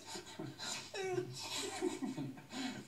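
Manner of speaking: sudden high giggle; high burst of laughter. Real, not acted. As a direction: crack up. Breaks into laughter.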